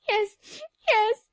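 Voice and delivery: high, loud tearful yes; sniffling. A narrator's reading. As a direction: sniffle it out through tears. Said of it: "Sally sniffed".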